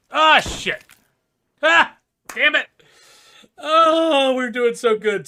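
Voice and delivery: high, loud wail of frustration; dismayed outcry. Real, not acted. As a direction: cry out in dismay as the good run collapses. Losing the game after doing well.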